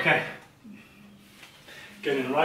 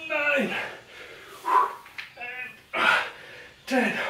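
straining; 7 semitones higher, 7 dB quieter, much slower than usual. Real, not acted. Straining through last reps.

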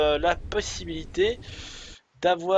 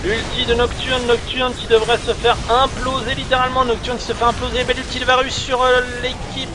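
higher, urgent esports commentary; excited play-by-play; 5 semitones higher, 4 dB louder, faster than usual. Real, not acted. Caster calling a team fight to viewers, excited play-by-play. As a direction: call the fight as it breaks out, pitched up.

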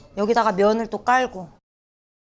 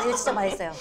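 ordinary talk, nothing marked

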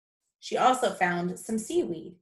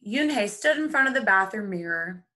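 ordinary delivery, nothing marked

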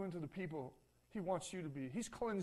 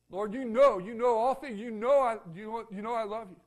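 pleading; 2 semitones higher, 10 dB louder than usual. Acted. Peter pleads, voiced in story.

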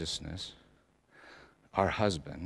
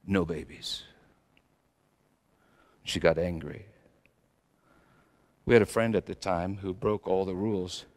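ordinary talk

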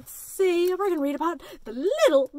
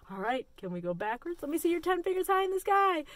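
ordinary talk, nothing marked

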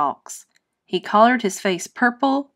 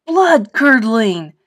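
loud, slow, thrilled cry; a vampire classmate shouting praise; 2 semitones higher, 7 dB louder, slower than usual. Acted. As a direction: drag it out in awe. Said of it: "shouted Vinny".

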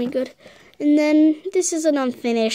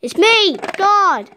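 higher and louder announcing; playful booming entrance; mock grand voice; 7 semitones higher, 4 dB louder, much slower than usual. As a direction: proclaim yourself grandly. Toy figure announces itself.

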